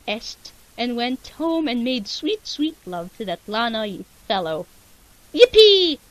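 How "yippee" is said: high, loud whoop; cheer. Real, not acted. Reader celebrates the ending with a loud cheer. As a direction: shout it with joy.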